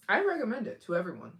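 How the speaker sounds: ordinary delivery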